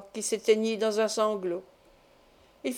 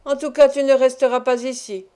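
louder, harsh threat; rough gruff; 3 semitones higher, 7 dB louder than usual. A narrator's reading. Man threatening the boy, rough and loud. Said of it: "en me prenant rudement".

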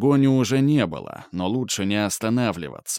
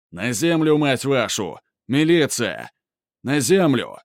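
loud commanding police yell; police shout; 3 semitones higher, 3 dB louder than usual. Acted. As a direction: bark orders at everyone. Милиция кричит при облаве. (милицейский окрик)

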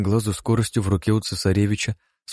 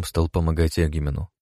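usual speaking voice

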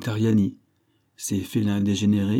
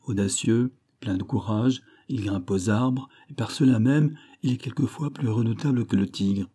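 ordinary delivery

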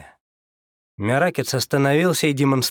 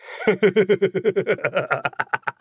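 high, hearty guffawing laugh; loud guffaw; 7 semitones higher than usual. Acted. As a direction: let out a big loud cackle. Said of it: "Громко загоготал он".

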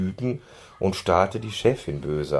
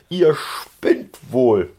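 loud, slow, furious; raging; 6 semitones higher, 6 dB louder, much slower than usual. A narrator's reading. Boss raging at the ponies, loud and slow. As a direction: rage at the ponies. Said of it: "tobte die Chefin".

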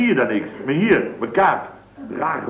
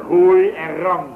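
slow, loud, higher mock hunter line; imitating the hunter; 5 semitones higher, 5 dB louder, slower than usual. Old man retelling the hunter's words to the receptionist, slow mock voice. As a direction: drag it out, louder and higher, mocking him. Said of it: "hij zegt".

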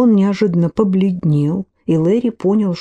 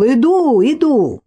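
calling out; 7 semitones higher, slower than usual. Acted. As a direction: shout it toward the ringing doorbell. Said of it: "крикнул".

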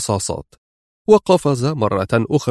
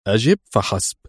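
short, restrained command; stern order. A narrator's reading. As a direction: order it firmly, without shouting. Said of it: "هتف أدهم في صرامة". (أمر صارم)

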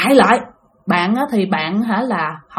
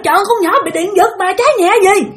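fast, high-pitched protest; exasperated correction; 9 semitones higher, faster than usual. Retold or imitated. Bystander correcting the false fire alarm, exasperated. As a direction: imitate an urgent bystander calling out.

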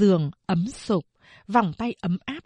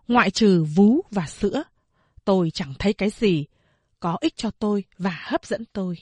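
plain reading voice

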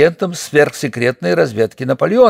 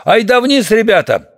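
higher-pitched, mild narrated shout; rally the men with a raised-pitch call; 7 semitones higher than usual. A narrator's reading. Rato calls his men downstairs, urgent mild shout. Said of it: "он крикнул сопровождавшим его".

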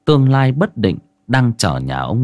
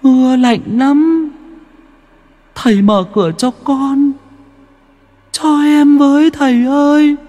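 put-on eerie child wail building from high to very high and loud; ghost child calling; 4 dB louder than usual. A narrator's reading. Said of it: "Nó cất tiếng gọi". (đứa bé ma gọi cửa, giọng trẻ con rợn người, cao dần và to dần)

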